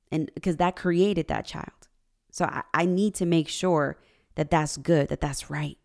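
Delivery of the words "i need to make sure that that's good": normal talking voice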